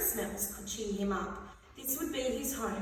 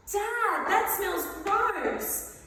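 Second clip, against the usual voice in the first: put-on whiny kid voice; 8 semitones higher, 4 dB louder, much slower than usual. Child complaining in story.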